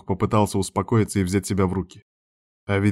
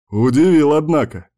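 louder, higher amused surprise; chortling; 6 semitones higher, 6 dB louder than usual. A narrator's reading. Friend reacting to baby news, amused and loud. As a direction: exclaim it with jovial astonishment. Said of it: "хохотнул Кир".

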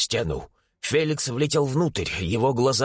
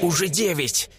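higher, mildly alarmed report; frightened; 4 semitones higher than usual. A narrator's reading. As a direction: report the rising price in alarm. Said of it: "испуганно доложила Маргарита". (испуганно, повыше)

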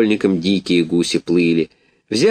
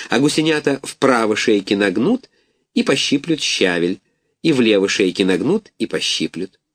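plain reading voice

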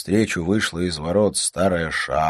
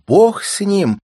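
loud, high dismissive exclamation; high, emphatic outburst; exclaiming; 9 dB louder, slower than usual. A narrator's reading. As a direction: toss it off in a raised voice. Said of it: "воскликнула Александра Павловна".

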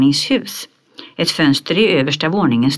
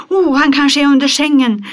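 much higher, louder cry of a panicked lady; scared shriek; 11 semitones higher, 6 dB louder than usual. Acted. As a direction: squeal it out in terror, a lady hiding from a beast. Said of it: "skrek den ena av damerna". (damen skriker högt och rädd)

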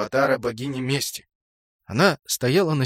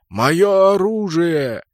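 loud, high, very drawn-out roar; outraged titan; 5 semitones higher, 5 dB louder, much slower than usual. A narrator's reading. Titan on the mountain claims his weapon, roaring slowly. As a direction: stretch it out in godlike fury.

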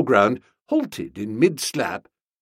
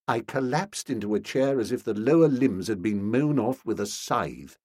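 ordinary delivery, nothing marked

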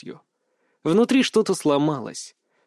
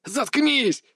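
high, torn scream; 10 semitones higher than usual. Acted. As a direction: scream it in pain. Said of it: "Крик вырвался из горла".

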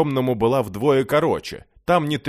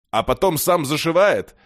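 higher, louder teasing question; wisecracking; 7 semitones higher, 5 dB louder than usual. A narrator's reading. One-legged beggar quips to amuse his pals, teasing. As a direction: toss out a jeering quip. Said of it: "сострил одноногий, снова развеселив дружков".

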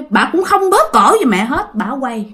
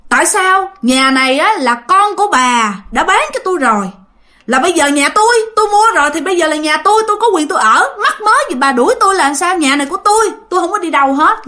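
high-pitched, insistent comeback; retold angry defiance; 6 semitones higher, faster than usual. Mother refuses to leave her bought house, angrily defiant. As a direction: stand your ground, building in indignation. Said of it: "Cái mẹ tức dữ lắm".

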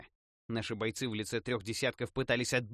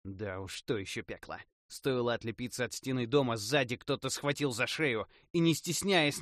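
usual speaking voice

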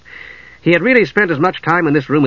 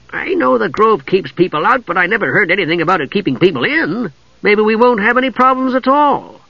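higher-pitched, lively kender voice; eager kender; 7 semitones higher than usual. A narrator's reading. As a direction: play a chirpy, restless kender. Tass speaks, optimistic.

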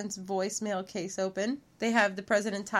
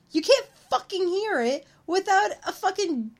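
exasperated rant; 8 semitones higher, 4 dB louder than usual. Real, not acted. Ranting at the movie.

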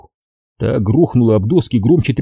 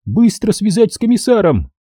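властный напор; 10 semitones higher than usual. A narrator's reading. Надя напирает.

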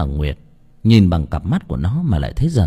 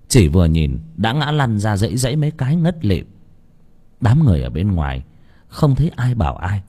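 ordinary delivery

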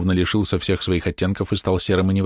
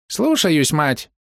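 high squeaky rat-man reply; 8 semitones higher, much slower than usual. Acted. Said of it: "Голос человека-крысы был писклявый".

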